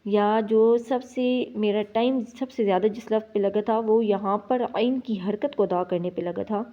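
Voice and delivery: ordinary delivery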